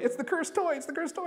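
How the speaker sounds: high-pitched, excited mock warning; mock alarm. Coworkers passing a toy back and forth, mock alarm. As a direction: raise the pitch and repeat it like a playful taunt. Chanting about the toy in the game.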